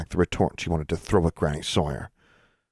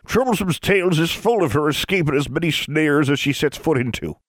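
put-on old granny voice; 10 semitones higher, 3 dB louder than usual. A narrator's reading. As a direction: speak like an old mountain granny. Granny Ford speaks.

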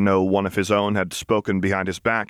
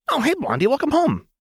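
much higher, oddly bright welcome; eerie cheerful greeting. Acted. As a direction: chirp it as if nothing happened. Jinx forgetting, eerily cheerful.

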